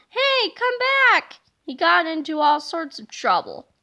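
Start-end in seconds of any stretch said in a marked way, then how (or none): 0.1-1.3 high, far-off shout of a chaser; calling after him. Acted. A bystander shouts after the fleeing hero, high and loud. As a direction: call after a runaway, pitched up high.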